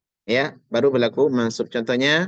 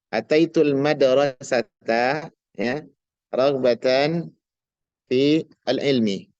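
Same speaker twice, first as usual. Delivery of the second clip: slow reading; slower than usual. Shown as a demonstration. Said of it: "teacher reads example sentence".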